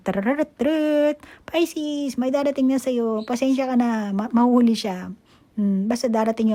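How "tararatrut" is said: high, slow mock trumpet call; trumpet fanfare. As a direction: blare it like a herald's trumpet. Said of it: "Nagtutrompeta na". (ginagayang tunog ng trumpeta)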